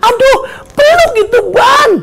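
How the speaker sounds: very high, strained cry; hammed-up exasperated shout; exasperated yell. Preacher urges drowning believer to hug the tyre, exasperated yell.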